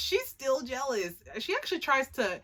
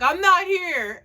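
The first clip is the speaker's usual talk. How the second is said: high, loud sulky cry; sulky high yell; 9 semitones higher, 11 dB louder, slower than usual. Retold or imitated. Jealous girl shouting from the tree, high and loud. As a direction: shout it from hiding. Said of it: "She's like".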